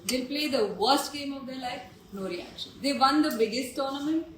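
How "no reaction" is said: very soft, low deadpan; unresponsive deadpan. Retold or imitated. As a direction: let it fall away without any reaction. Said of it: "These two are like".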